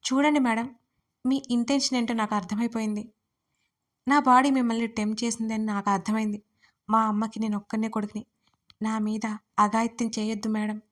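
usual speaking voice

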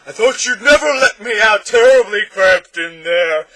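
huge high-pitched slow bellow; bellowing monster. A narrator's reading. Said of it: "he bellowed".

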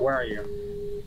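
slow soft creepy call; slow spooky call. Pretend. Player softly calling the hidden ghost, slow and spooky. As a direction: stretch it out slowly and softly.

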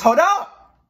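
startled interjection; sudden hold up. Real, not acted. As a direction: cut in abruptly. Streamer interrupts game narration.

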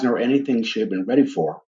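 usual speaking voice

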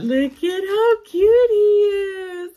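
high, loud, slow coo; excited gush. Real, not acted. Gushing over snowman.

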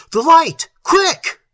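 very high, loud, desperate cry; almost scream the order; near scream. A narrator's reading. Little demanding the light at the last moment, almost screaming. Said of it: "Little almost screamed the words".

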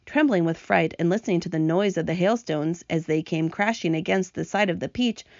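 ordinary delivery, nothing marked

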